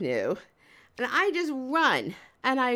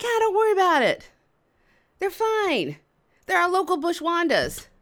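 higher, airily unconcerned reply; breezy dismissal; 5 semitones higher than usual. Retold or imitated. Guys explain the swimming girls, breezy dismissal. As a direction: imitate a local brushing it off. Unbothered guys answer, acted.

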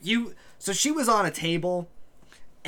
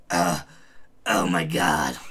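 much lower, loud pained groan; villain groaning in pain; 11 semitones lower, 5 dB louder than usual. Acted. Villain after being knocked off the table, low pained groan. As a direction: groan it from the floor. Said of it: "you just hear her go".

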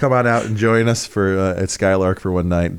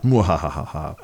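low theatrical evil cackle; evil villain laugh; 5 semitones lower, 3 dB quieter, slower than usual. Pretend. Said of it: "My nefarious plot".

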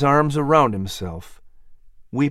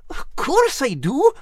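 playful cat voice; 13 semitones higher than usual. Acted. Cat answering the offer.

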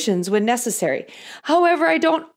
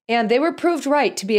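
ordinary talk, nothing marked

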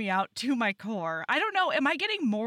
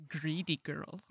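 put-on Russian accent; 6 semitones lower, 8 dB quieter than usual. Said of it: "in a Russian accent".

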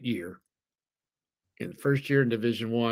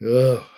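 groan; 9 dB louder than usual. Real, not acted. Torn over a tough pick.

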